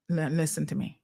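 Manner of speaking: soft, low, controlled warning; calm voice mode. Retold or imitated. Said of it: "calm voice mode".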